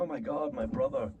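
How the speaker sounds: a soft, stunned murmur at an empty room; hushed dismay. Shown as a demonstration. Actor discovering his brother's empty apartment, quietly stunned. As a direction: take it in quietly, struck by what's gone. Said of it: "create the place and go".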